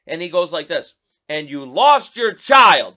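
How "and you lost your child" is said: loud mock yell, slowed down; imitating a yell. Host mocking the president's yell at reporters, loud. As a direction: bellow it like an angry old man at a press conference. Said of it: "yells it, screams".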